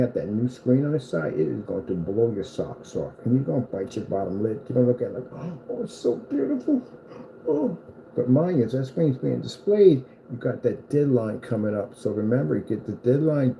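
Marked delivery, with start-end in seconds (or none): 5.7-7.8 high, dreamy mock gush; a hammed-up swooning admirer; mock awestruck. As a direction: coo it like you're smitten. Imagined reaction to the screen.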